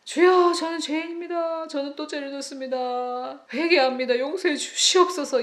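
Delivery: high, lamenting plea for forgiveness; mock wailing prayer. Believer kneeling before God, high mock tearful confession. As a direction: parody a weeping penitent.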